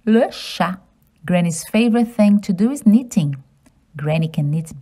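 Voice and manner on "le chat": le chat as luh sha, silent t; French pronunciation. Shown as a demonstration. Teaching children le chat in French. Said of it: "Cat in French is".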